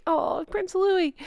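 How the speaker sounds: high, soft, drawn-out aww; fond cooing. Real, not acted. Host naming Catherine's new baby, fond cooing. Coos over the baby prince.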